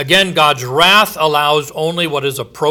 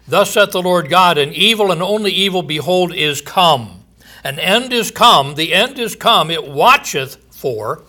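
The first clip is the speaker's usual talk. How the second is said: raised, ringing proclamation of doom; scripture reading; 3 semitones higher, 3 dB louder than usual. Acted. Preacher proclaiming judgment to his listeners, booming. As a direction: preach the verse out to the congregation.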